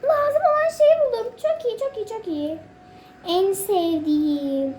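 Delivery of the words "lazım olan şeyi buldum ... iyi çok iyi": heyecanlı sevinç. Real, not acted. Finds the needed piece, thrilled.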